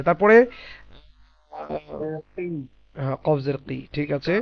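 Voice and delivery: ordinary talk, nothing marked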